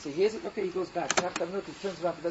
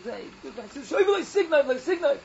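retold screaming; 6 semitones higher, 4 dB louder than usual. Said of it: "he starts screaming".

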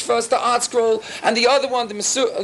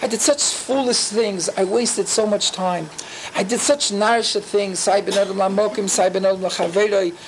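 heartfelt confession; 3 semitones lower than usual. Acted. A worshipper confessing to Hashem on Yom Kippur, remorseful. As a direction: confess with feeling, drawing the words out. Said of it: "But at least the feeling".

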